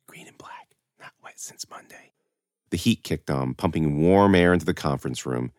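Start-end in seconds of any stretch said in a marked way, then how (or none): 0.1-2.1 very soft voiceless whisper; whispering to herself. A narrator's reading. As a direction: whisper privately, as if to yourself. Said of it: "started to whisper to herself".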